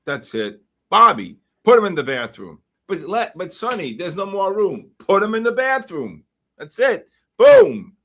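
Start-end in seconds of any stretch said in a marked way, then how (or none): 0.9-2.6 loud barked order to his henchman; mob boss order. Acted. Acted scene repeated. 2.9-4.9 higher, whiny protest to the boss; protesting henchman. Acted. Henchman reply repeated. 5.0-7.1 high, loud triumphant order; excited order. Real, not acted. Excited order as market drops. 7.4-8.0 a huge, high, loud boom of victory; triumphant shout. Real, not acted. Trade hits target.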